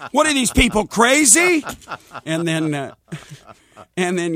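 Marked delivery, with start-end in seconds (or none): 0.1-1.6 higher, rising in fed-up disbelief; exasperated disbelief. Retold or imitated. His standard morning phrase, higher, mock exasperated. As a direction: throw up your hands at them. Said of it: "That's my standard phrase".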